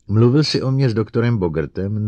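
loud, pressing question; demanding interrogation. A narrator's reading. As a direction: question the robot forcefully. Director sharply interrogates robot. (hlasitý důrazný výslech)

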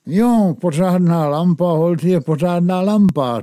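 hlasitě, vysoko a nadšeně; nadšená chvála. Acted. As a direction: pochval lampu s hraným nadšením. Bednář nadšeně chválí svou lampu. (zvučné, rozjařené pochvalování)

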